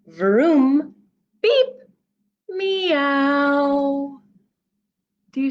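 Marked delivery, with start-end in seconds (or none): 0.1-4.2 vroom, high beep, meow; kitty digger noises. Acted. Reader voicing the kitty excavator. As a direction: rumble then meow like a kitten.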